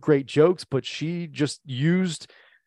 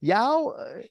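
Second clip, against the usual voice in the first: imitating a thick Southern twang; 9 semitones higher than usual. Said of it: "the thickest twang".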